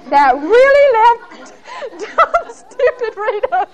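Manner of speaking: high, giggling self-mockery; laughing at herself. Real, not acted. Speaker mocks herself for being left to answer, laughing. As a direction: laugh at your own foolishness.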